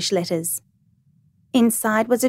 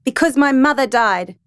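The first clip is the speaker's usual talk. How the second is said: restrained shout, higher pitch; 5 semitones higher, 4 dB louder than usual. A narrator's reading. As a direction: raise your voice as you turn on someone. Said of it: "I turned on her and shouted".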